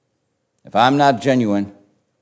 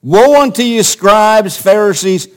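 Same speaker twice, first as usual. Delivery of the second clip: higher, louder condemning proclamation; very forceful woe; 7 semitones higher, 6 dB louder than usual. Shown as a demonstration. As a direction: thunder it at the religious leaders. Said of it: "I believe perhaps in a very forceful voice".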